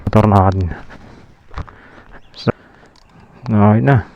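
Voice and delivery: talking over a running motorbike engine; engine noise behind. Real, not acted. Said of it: "para marinig niyo yung makina".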